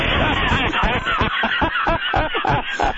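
sustained high laughing; real laughter. Real, not acted. Laughing at the Aflac duck costume joke, real high laughter. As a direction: burst out laughing and keep going.

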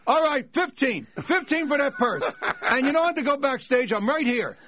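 high, loud mock heckling from the crowd; heckler calling out. Father bidding on the purse from his seat, high loud call-out. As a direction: call out a bid from the audience, hammed up.

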